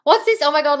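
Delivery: high, loud, startled question; startled exclamation at chat; sudden surprise. Real, not acted. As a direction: caught off guard by a comment.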